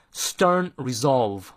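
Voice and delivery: "stern" slow, its er vowel drawn out long; "stern resolve" spoken slowly, "stern" held long. Shown as a demonstration. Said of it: "长一".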